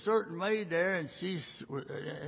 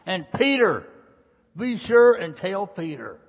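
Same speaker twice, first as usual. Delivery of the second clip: ringing, louder preaching call; loud emphasis; 3 semitones higher, 12 dB louder than usual. Acted. Preacher proclaims the angel's message with loud emphasis. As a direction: lift the voice and proclaim it.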